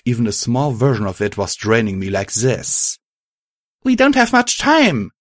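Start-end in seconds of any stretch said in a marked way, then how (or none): 3.8-5.1 high-pitched, louder shout; raised high voice, shouting to friends; urgent shout. A narrator's reading. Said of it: "I shouted".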